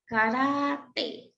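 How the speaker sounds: caráter drawn out with RÁ held; very slow caráter, leaning on RÁ; stressed penultimate syllable. Shown as a demonstration. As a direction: stretch the middle of caráter, ca-RÁÁÁ-ter. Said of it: "possuem a penúltima sílaba como sílaba mais forte".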